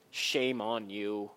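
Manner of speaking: low, slow mock scolding; slow, deep mock rebuke of the suffering Job. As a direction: shame him slowly and deep. Mocking the judging friends.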